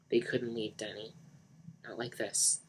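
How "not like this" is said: ordinary delivery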